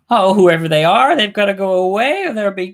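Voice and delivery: a hint of a flustered society matron; Missus Peacock. Retelling the scene from Clue, a lightly voiced flustered lady. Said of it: "she's like".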